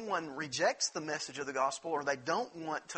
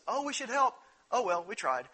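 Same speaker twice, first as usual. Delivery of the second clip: careless dinosaur; 7 semitones higher, 4 dB louder than usual. Retold or imitated. Dinosaur shrugs off danger.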